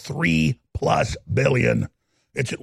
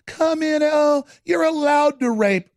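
mocking high welcome; 9 semitones higher, slower than usual. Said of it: "they're all just like".

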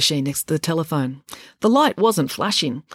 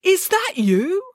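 surprised; 13 semitones higher, slower than usual. A narrator's reading. Joe in disbelief.

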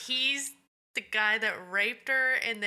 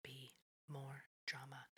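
whisper; 6 semitones lower, 19 dB quieter than usual. Shown as a demonstration. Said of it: "repeated in whisper".

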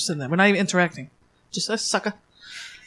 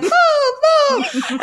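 high laughing yell. Retold or imitated. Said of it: "And I was like".

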